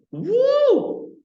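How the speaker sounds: high, loud whoop; mock cheer. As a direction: whoop like it's a party. Mock excitement over burning.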